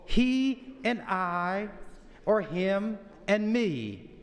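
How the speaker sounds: "he and I" and "him and me" spelled out slowly and carefully; teacherly, slow correct forms; slow correct phrasing. Shown as a demonstration. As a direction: lay out "he and I" then "him and me" word by word, slow. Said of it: "It should be".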